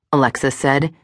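ordinary delivery

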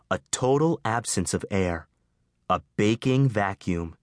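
plain reading voice

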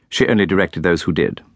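usual speaking voice

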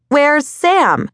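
very high-pitched call across distance. A narrator's reading. Woman to distant kid so he hears, high calling out. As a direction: call it out so he'll hear you. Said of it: "cupped her hands around her mouth".

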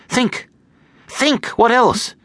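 high-pitched, insistent urging; urgent. A narrator's reading. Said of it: "said Amy urgently".

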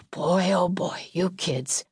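sighing, slow disappointment; sigh, then draw it out, fading; weary dad. A narrator's reading. Father shaking his head at his kids, weary.

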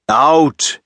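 loud bellowed single word. A narrator's reading. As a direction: bark him out of the room, loud. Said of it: "he bellowed".

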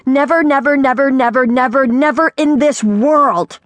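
high, rolling tirade of refusal; outraged yell. A narrator's reading. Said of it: "she yelled".

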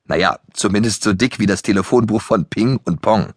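ordinary delivery

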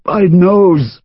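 high pained moan. Acted. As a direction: groan it out, hurting.

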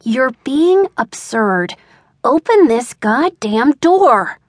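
slow, emphatic scolding; slow, fed-up command through a door; exasperated order. A narrator's reading. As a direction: space the words out, building to a demand.

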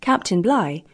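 usual speaking voice

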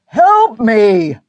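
loud, high roar for help; desperate roar for help. Acted. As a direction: bellow it across the wreck. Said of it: "he roared".

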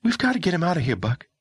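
put-on high, startled girl's voice; stunned young woman. Girl character voice.

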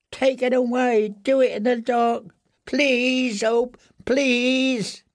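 high, thin frightened begging; terrified pleading. A narrator's reading. Prisoner hurt by the torchlight begs for darkness, terrified pleading. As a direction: beg in a high, drawn-out whimper.

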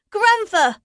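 high, loud panicked shout; loud high cry of a frightened boy. A narrator's reading. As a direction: call out in alarm as a young boy. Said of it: "shouted his young grandson".

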